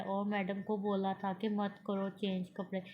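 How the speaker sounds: ordinary delivery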